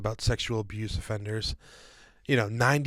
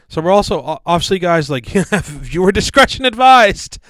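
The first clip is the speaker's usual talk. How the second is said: loud warning broken up by laughter; laughing; 7 semitones higher, 13 dB louder than usual. Real, not acted. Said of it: "I'm laughing because it's".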